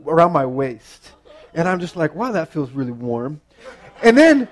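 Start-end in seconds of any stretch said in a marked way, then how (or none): 2.2-3.4 low, soft musing; understated puzzled realization; acted quiet wonder. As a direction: think it aloud, low and hushed. Himself lying there, slowly noticing warmth.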